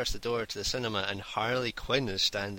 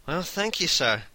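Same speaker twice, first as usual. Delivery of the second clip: high, louder mock-exasperated thanks; 6 semitones higher, 5 dB louder, slower than usual. Costumed cinema worker answering a compliment, exasperated. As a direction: imitate a worker's exasperated reply, pitch jumping up then dropping. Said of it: "Kinda, like, exasperated".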